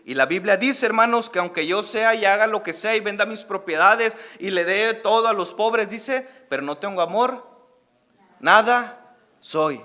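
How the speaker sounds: loud, fired-up sermon delivery; preaching. Acted. Pastor preaching loudly to his congregation. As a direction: preach it out to the whole church, full force.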